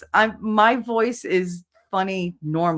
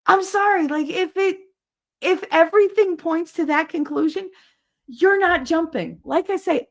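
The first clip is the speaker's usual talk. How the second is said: much higher put-on voice; hammed-up indignant rant; 11 semitones higher than usual. Streamer insisting she has grounds for her conclusion, high mock-indignant voice.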